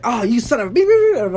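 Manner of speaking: high, loud bleeped-out curse yell; mock pained cursing. Hurt on the construction site, a hammed-up loud curse. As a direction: swear like you just got hurt at work. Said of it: "when I get hurt".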